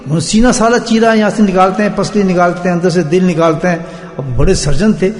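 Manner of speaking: ordinary delivery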